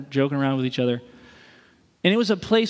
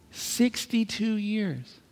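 slow, quiet, higher, lightly voiced old man; old widower's answer; 5 semitones higher, 6 dB quieter, much slower than usual. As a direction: let it land softly like grief he has lived with.

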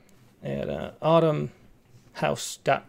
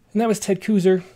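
normal talking voice

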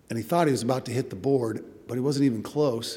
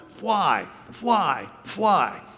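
retold calling out; 5 semitones higher, much slower than usual. Friend calling to blind long jumper, calling out. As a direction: imitate a guide's higher, drawn-out call. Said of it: "he'd start calling out".

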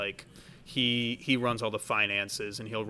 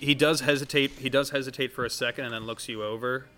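ordinary talk, nothing marked

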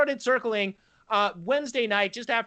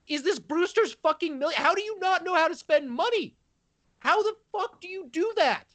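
incredulous rising rant; 7 semitones higher than usual. Real, not acted. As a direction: voice climbing higher in disbelief.

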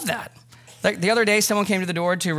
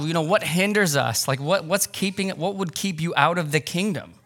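usual speaking voice

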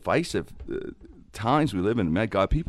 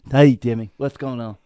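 imitating cousin Victor; 3 semitones higher, 5 dB louder than usual. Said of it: "cousin Victor will be like".

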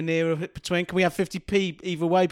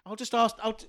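normal talking voice

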